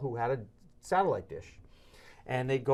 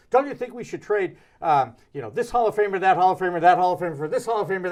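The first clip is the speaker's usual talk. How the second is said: mock trade pitch; 5 semitones higher, 8 dB louder than usual. Imitating Howie's trade pitch.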